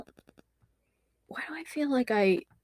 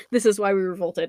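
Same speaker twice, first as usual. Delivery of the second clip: ordinary talk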